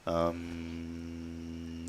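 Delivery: long, low, quiet ummm; drawn-out um. Shown as a demonstration. Host teases guest about filler, drawn-out um. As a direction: hold a soft low hum of hesitation. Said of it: "that's kind of a verbal placeholder".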